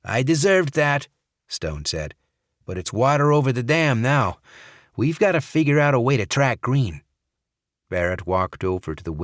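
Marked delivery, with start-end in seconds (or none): 0.0-1.1 Stone's voice pitched up and louder, owning a mistake; Stone voiced. A narrator's reading. Stone admits fault to colleagues, voiced higher and louder. 2.6-7.0 Stone's voice pitched up and louder, owning a mistake; Stone voiced. A narrator's reading. Stone admits fault to colleagues, voiced higher and louder.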